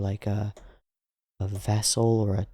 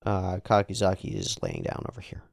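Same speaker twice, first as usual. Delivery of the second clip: ordinary talk, nothing marked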